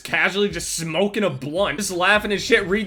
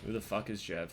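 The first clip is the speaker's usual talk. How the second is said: repeated almost under the breath, much softer; quiet echo; 12 semitones lower, 16 dB quieter than usual. Shown as a demonstration. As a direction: let it fade out like a sigh. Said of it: "repeats it much quieter".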